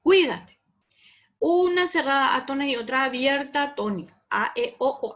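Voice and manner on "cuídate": CUI-date, loud high punch on "cui"; first syllable. Shown as a demonstration. Teacher pointing out the first syllable, "cuídate" punched on cui. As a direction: hit the start of "cuídate" hard as one syllable. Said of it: "La primera es".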